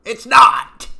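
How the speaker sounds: a sudden furious yell; shouted outburst. Acted. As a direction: bark it out in outrage. Outburst in rant.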